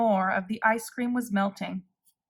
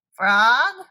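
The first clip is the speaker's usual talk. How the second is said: called out louder; cried; 7 dB louder than usual. A narrator's reading. Toad calling out for Frog, loud call. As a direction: call for your friend. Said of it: "cried the thing".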